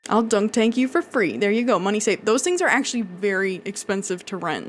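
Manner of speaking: normal talking voice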